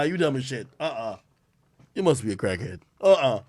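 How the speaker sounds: ordinary talk, nothing marked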